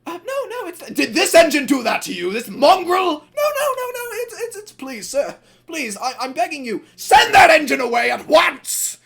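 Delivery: very high, frantic pleading; Evening Star begging the manager, very high and desperate. Retold or imitated. Engine begging the manager, high-pitched panic. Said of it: "he's like".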